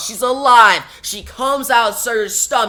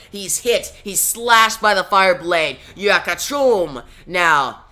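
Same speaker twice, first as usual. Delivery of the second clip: usual speaking voice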